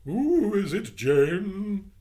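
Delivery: put-on high, loud sour old lady voice; sour woman. Missus Biddle calling sourly to her maid. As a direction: snap it out sourly as a cross housewife. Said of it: "she answered in a sour voice".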